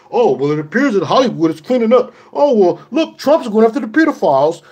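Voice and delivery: high, loud mocking of the gullible. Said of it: "don't fall for the okie doke".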